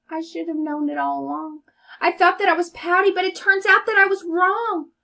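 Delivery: high, loud and fast joyful fish; excited happy fish. Acted. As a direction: burst out with joy. Fish's happy realization.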